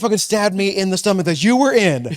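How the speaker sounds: hammed-up maternal outrage; guilt-tripping mother. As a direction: hammer home the comic guilt trip.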